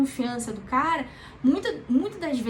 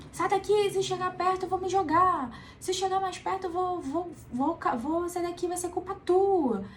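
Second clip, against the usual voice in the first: higher, upset voice pushing the helper away; distressed person on the ledge; 7 semitones higher than usual. Retold or imitated. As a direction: play someone on the edge warning you off. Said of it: "Que geralmente acontece". (pessoa em crise afastando quem ajuda, voz mais aguda e aflita)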